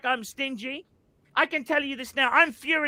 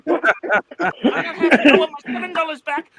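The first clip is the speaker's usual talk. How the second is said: laughing through anger; 2 semitones higher, 5 dB louder than usual. Real, not acted. Laughing while complaining.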